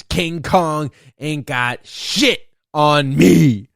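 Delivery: big emphatic quote from a film tough guy; Denzel. Retold or imitated. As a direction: punch each word with long pauses. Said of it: "I'm like fucking Denzel".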